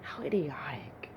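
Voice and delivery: low, soft, whispery scorn; scornful muttered aside. Acted. David privately mocking Goliath's taunt, a low whispered aside. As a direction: drop it to a dismissive whisper.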